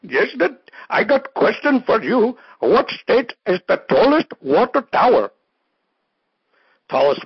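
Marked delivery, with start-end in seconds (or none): 0.0-5.3 high, squeaky caller voice; third fake caller. Last fake caller asks a silly question, high squeaky voice. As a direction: ask it like a daffy caller on the line. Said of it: "We got one more caller here".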